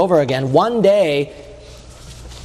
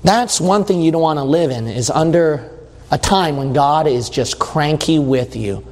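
ordinary talk

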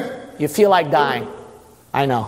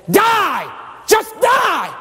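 devil yelling; 8 semitones higher, slower than usual. Acted. Preacher voicing devil at dying Jesus, shouting. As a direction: spit out the devil's command.

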